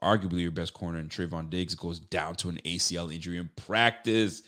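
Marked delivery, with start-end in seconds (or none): none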